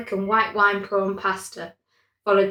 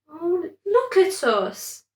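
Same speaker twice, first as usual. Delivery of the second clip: weeping a little; 9 semitones higher, slower than usual. A narrator's reading. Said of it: "She began to weep a little".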